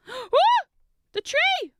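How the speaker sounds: high, loud startled gasp; real alarmed woo. Real, not acted. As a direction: gasp as the tree topples. Tree piece falls.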